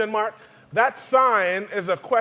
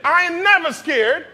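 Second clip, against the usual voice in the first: bold declaration; 9 semitones higher, 3 dB louder than usual. Shown as a demonstration. Preacher leads the congregation in shouting the title, loud and bold. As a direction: proclaim it fearlessly. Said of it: "You gotta say it".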